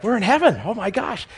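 high, lightly amazed gasp of words; mock amazement. Acted amazement on arriving in heaven.

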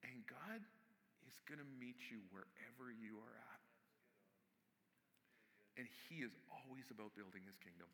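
quiet, measured pastoral encouragement; hushed earnest preaching. Real, not acted. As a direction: drop your voice and preach it gently. Preacher drops into hushed, earnest exhortation.

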